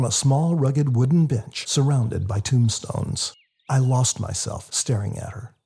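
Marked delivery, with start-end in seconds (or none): none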